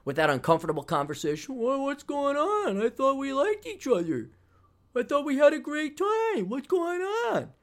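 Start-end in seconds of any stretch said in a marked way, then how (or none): 1.6-6.5 mock needy ex. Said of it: "you poor girls". 6.5-7.5 high, slowed whiny plea; mock the clingy guy, stretched and high; needy ex. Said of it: "you poor girls".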